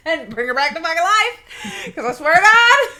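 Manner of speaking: high, loud plea breaking into laughter; laughing mock demand. Storyteller retelling her prayer to God, laughing loudly.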